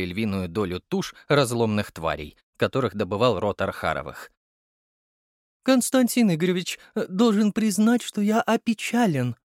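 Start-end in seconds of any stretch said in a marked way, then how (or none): 5.6-9.4 foppish dandy. A narrator's reading. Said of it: "сказал Щеголь с закрученными усиками".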